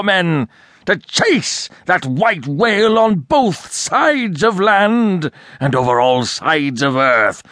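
a captain rallying his crew to the hunt; swelling oath, slow and high; shouting, building. A narrator's reading. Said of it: "he shouted out".

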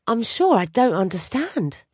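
a hint of bewildered protest; confused. A narrator's reading. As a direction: say it lost and unsure.

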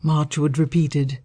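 ordinary delivery, nothing marked

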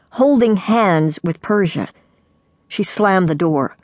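ordinary delivery

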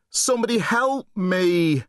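high, pleading call for help; desperate plea. Acted. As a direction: plead for rescue. Boy begs in shame.

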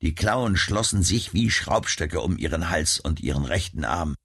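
ordinary delivery, nothing marked

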